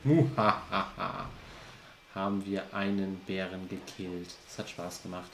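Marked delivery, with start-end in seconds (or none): 0.0-1.3 slow evil laugh, muhahaha; villain's gloating laugh; evil chuckle. Acted. Bär besiegt, triumphierend. (böses Lachen)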